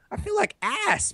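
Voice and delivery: high, squeaky inner whine; high-pitched mock misery; whiny complaint. Voices his inner complaint.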